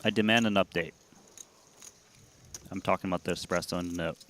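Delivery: usual speaking voice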